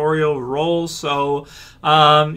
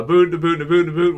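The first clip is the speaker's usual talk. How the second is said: mock Canadian accent, slightly raised; terrible Canadian accent; 4 semitones higher than usual. Shown as a demonstration. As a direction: exaggerate the Canadian O in "boot". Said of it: "with my terrible Canadian accent".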